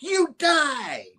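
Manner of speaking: high-pitched hammed-up threat; furious mock cry. Janice clawing her dad's face, high mock fury. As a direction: shriek it as a vengeful daughter. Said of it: "She's like".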